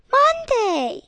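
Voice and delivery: high, loud excited shout. Acted. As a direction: yell the answer, high and loud. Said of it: "shouts Bobby Bear".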